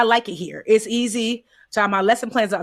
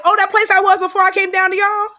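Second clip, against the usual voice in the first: put-on much higher, louder, breezy child voice; imitating a small child, pitched way up; little girl voice; 11 semitones higher, 5 dB louder than usual. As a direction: play the toddler, bright and matter-of-fact. Voicing three-year-old niece on heaven.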